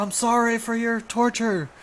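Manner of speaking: high, slow put-on apologetic voice; hammed-up pitiful sorry; mock apology. Mock quote of dog.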